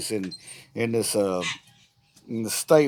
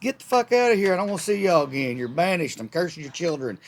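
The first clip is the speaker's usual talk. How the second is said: hammed-up angry God throwing them out; furious God; 5 semitones higher than usual. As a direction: bellow the banishment like an outraged God. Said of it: "and he said".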